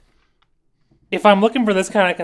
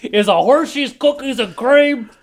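put-on gruff old fisherman; 7 semitones higher, slower than usual. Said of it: "sounded like you were an old fisherman".

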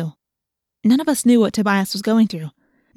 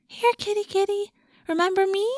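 much higher and softer, coaxing a cat; high pet voice; 11 semitones higher, 3 dB quieter than usual. A narrator's reading. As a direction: coo at the kitty. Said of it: "in a high talking to animals voice".

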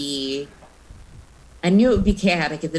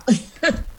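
embarrassed laugh; 8 semitones higher than usual. Real, not acted. Laughing after choking on water.

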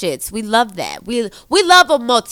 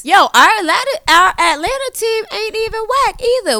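excited and high; 11 semitones higher, 3 dB louder than usual. Real, not acted. Hyping Atlanta team.

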